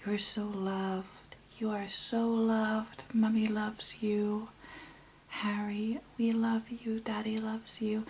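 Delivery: put-on slow, tender whispered lullaby to a baby; soft, slow whisper of a loving mother. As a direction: hush it like a mother over a crib. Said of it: "like, talking to Harry".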